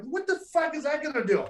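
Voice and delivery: high-pitched, incredulous retort; retold incredulity. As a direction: scoff at a useless suggestion. Retold reaction to useless glove offer.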